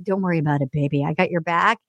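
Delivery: mock Dale, deep and soothing. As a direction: reassure her like a cheesy protector.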